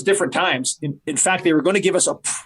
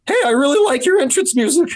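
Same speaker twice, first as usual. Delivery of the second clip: put-on much higher and louder, a squeaky nervous kid; prepubescent teen voice; 11 semitones higher, 4 dB louder than usual. Nervous young wrestler to Bob Holly, squeaky teen voice. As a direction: sound like a nervous boy whose voice hasn't broken. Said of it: "this is what I sounded like, a prepubescent teen".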